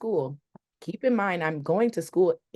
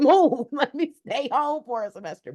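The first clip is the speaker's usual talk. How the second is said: laughing retort; 9 semitones higher than usual. Retold or imitated. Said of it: "I said".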